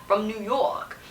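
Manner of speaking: mock New York accent on a short reply. Reviewer repeating how the voice actor says it, New York accent. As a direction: imitate an overdone New York accent, lightly. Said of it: "literally says it like that".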